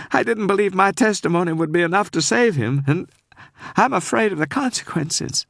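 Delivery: holding back tears as she pleads; tearful Southern woman. Acted. Frightened caller begging the detective to save Cooper, tearful. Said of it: "I was sure she was sobbing".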